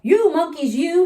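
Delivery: high, loud scolding of the monkeys; scolding peddler. A narrator's reading. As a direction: wag a finger and scold them. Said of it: "he said, shaking his finger".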